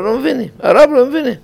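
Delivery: high, hurried plaintive chorus; protesting pupils. Acted. Pupils tell their rushing teacher they are lost, high-pitched protest. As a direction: pitch it way up like pupils complaining quickly.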